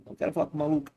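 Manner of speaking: high-pitched playful protest; mock incredulous. Streamer refusing to talk to the mad old mother, mock incredulous. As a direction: push it high, as if backing away from madness.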